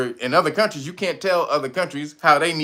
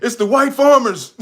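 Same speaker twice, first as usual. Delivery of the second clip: mock Trump; 8 semitones higher, 5 dB louder than usual. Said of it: "He said".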